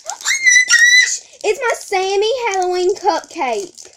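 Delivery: high, loud, slow squealing shout; delighted squeal. Real, not acted. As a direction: squeal at finding her dream squishy. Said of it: "Sorry if I squeal a lot".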